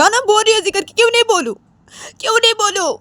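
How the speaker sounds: high-pitched indignant wail; upset, insistent comeback; defiant retort. Acted. Defiant retort in acted quarrel.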